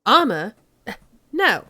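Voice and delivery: loud Cockney denial. Acted. Suspect asked about the missing armor, loud Cockney. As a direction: blurt it as a Cockney.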